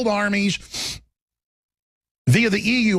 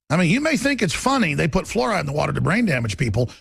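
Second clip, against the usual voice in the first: ordinary talk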